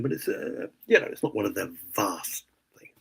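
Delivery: usual speaking voice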